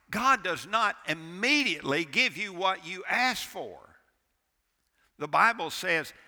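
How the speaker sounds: loud, driving preacher's build; fiery sermon delivery; preaching at full force. Acted. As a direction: hammer the point home from the pulpit.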